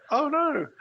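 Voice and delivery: high, loud mock cry of alarm; mock dismay.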